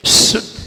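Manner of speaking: शुद्ध with a long, forceful "sh"; whistling sibilant. Shown as a demonstration. Teacher shows how it is said, whistling शुद्ध. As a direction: hiss शुद्ध, cutting the end short. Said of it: "शुद्ध कैसे बोला जाता".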